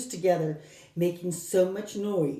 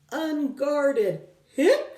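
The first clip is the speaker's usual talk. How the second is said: hic; 8 semitones higher, 5 dB louder, much slower than usual. A narrator's reading. Story sound of a hiccup.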